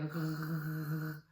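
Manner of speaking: a soft mock gargle in the throat; pretend gargling. Shown as a demonstration. Therapist showing a child a gargle. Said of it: "pretend that I'm gargling, so I go".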